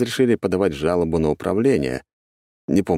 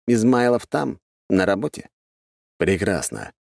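ordinary delivery

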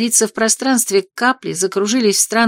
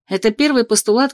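usual speaking voice

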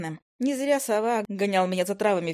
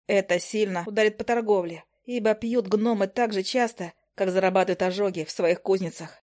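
usual speaking voice

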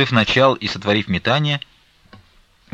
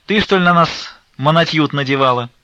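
cheeky retort; 7 semitones higher, 5 dB louder than usual. A narrator's reading. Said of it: "отвечала старица Флёнушка".

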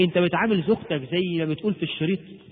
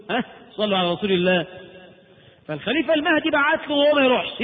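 ordinary talk, nothing marked

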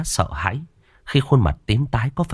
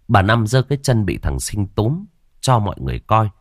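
ordinary delivery, nothing marked

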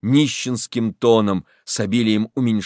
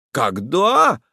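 raised, challenging retort; cocky echo; 6 semitones higher, 3 dB louder than usual. Acted. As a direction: throw the question back cockily. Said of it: "с задором переспрашивает Федченко".

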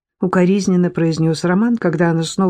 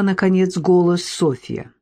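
ordinary delivery

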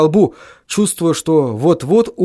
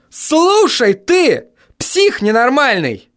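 high, drawn-out enraged cry; high-pitched exasperated scream; furious yell; 10 semitones higher, slower than usual. A narrator's reading. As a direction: yell slowly down the phone. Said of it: "завопил я в трубку".

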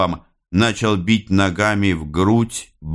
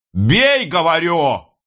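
very high, impatient cry; shouted order; 11 semitones higher, slower than usual. A narrator's reading. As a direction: scream the command to strike. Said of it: "крикнул Становой". (яростный крик-приказ)

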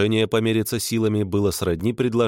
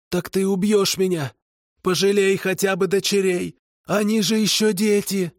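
pleading kikimora; 13 semitones higher than usual. A narrator's reading. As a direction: beg for mercy for her children. Kikimora begging for her daughters.

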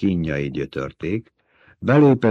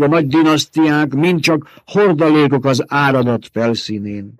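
higher, louder stern lecture; lecturing old mother superior; 8 semitones higher, 6 dB louder than usual. Acted. Old woman lecturing Jessica on the species. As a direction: hammer the lesson into her.

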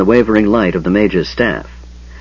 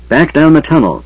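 firm order, pitched up; 7 semitones higher, 4 dB louder than usual. A narrator's reading. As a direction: give a brisk, firm command. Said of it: "Tanis said firmly".

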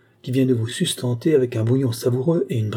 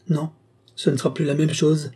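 understated despair, pitched higher; 6 semitones higher than usual. A narrator's reading. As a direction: protest with a touch of desperation. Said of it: "répliquai-je désespéré".

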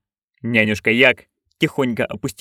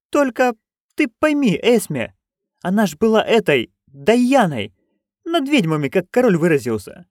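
put-on higher, eager old crone; Nanny Ogg voice; 10 semitones higher than usual. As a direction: pitch up into a gleeful old busybody. (высокий азартный голос старой ведьмы)

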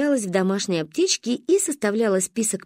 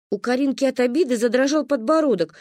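plain reading voice

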